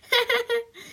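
high-pitched acted giggle; mocking schoolchild's laugh; quick peal of high giggles; classmates' laughter. A narrator's reading. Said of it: "laughed Punk's classmate".

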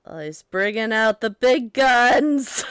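slow, loud, excited ringside call; wrestling commentator. Acted. Commentator hyping the wrestler's big move, loud and slow. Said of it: "like, the commentator".

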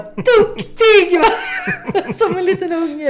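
squealing high and loud through laughter, praising the car; laughing with joy. Retold or imitated. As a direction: giggle it out like a delighted little kid. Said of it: "Jag satt bara och skrattade när jag körde".

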